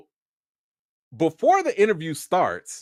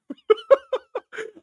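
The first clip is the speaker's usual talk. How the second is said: a high amused laugh. Real, not acted. Vlogger laughing at being recognized, high burst.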